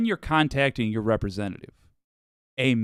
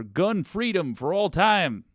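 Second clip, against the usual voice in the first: drawn-out mock Southern guy, higher pitch; Southern accent; 5 semitones higher than usual. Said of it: "it's always a Southern guy".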